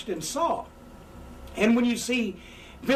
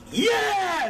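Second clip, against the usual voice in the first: hammed-up babyface yell, pitched way up; high fired-up yell; 11 semitones higher, 4 dB louder than usual. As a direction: whip up the crowd with a big high yell. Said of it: "if you don't feel the people with you".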